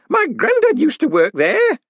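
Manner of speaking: gossiping townswoman; nosy old woman. Acted. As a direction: repeat it, tugging for attention. Woman in crowd chatting.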